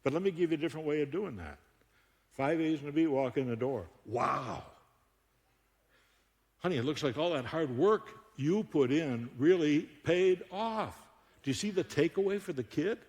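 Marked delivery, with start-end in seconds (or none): none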